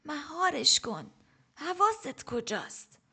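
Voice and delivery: put-on high, slow, sharp old woman's scolding; snapping rebuke. A narrator's reading. Old teacher snapping at her distracted pupil. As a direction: scold the student sharply and slowly. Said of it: "باگرا با تشر گفت".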